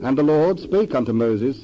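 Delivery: plain reading voice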